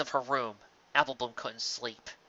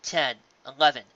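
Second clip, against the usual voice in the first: plain reading voice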